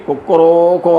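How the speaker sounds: drawn-out rooster call; rooster crow. Retold or imitated. Storyteller imitating Indra's fake cockcrow before dawn, mimicked rooster crow. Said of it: "కోడిలాగా కూశాడు".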